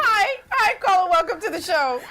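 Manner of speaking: high, loud, laughing hello; excited greeting. Real, not acted. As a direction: burst in with an excited welcome. Host greets next caller, laughing.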